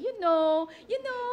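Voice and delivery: playful mock "you know", lilting upward; mock English speaker. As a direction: tease someone who only knows this phrase. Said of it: "puro yun lang sinasabi".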